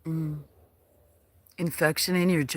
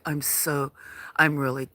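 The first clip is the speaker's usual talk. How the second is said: usual speaking voice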